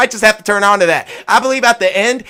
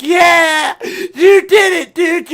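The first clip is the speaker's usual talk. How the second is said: joyful cheer; 6 semitones higher, much slower than usual. Retold or imitated. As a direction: cry out in relief and joy. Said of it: "He was like".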